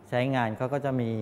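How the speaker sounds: normal talking voice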